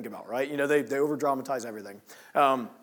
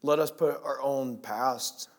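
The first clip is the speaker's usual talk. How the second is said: prayer. Acted. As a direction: pray humbly for the congregation. Closing prayer.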